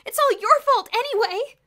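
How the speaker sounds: very high, loud accusing shout; upset accusation. A narrator's reading. Diane blaming Bruno, shouting upset. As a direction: throw the blame at him. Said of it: "quite upset".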